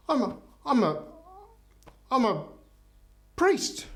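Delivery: high, halting mock indignation; scandalised priest. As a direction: stammer it in pieces, pitch leaping on the last word.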